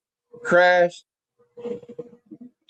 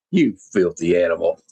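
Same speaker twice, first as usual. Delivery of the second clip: much lower, sneering retort; disgusted insult; 10 semitones lower than usual. Retold or imitated. Flagg insults Cobra Commander for hiding behind girl scouts, low scornful retort.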